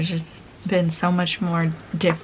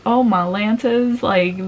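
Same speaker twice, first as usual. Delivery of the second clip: ordinary talk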